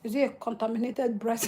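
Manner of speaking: ordinary talk, nothing marked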